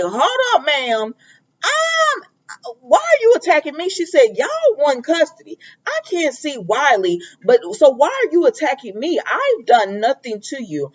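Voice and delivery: high-pitched mock indignation; acted-out defensive pushback; indignant protest. Acting out Nina protesting an attack.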